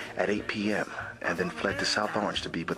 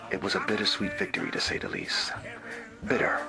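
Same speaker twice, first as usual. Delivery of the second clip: ordinary delivery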